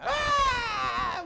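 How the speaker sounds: sudden high shouted cry; imitated wail. Father imitating his daughter's crying, a high loud wail.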